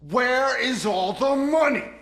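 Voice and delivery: high, loud, outraged yell at the empty vault; a furious shout at an empty vault. Acted. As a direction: bellow it, stunned and furious.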